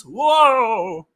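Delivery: loud, high drawn-out whoa; hammed-up whoa. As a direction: hype it up with a big, high whoa. Hyped reaction to his own announcement.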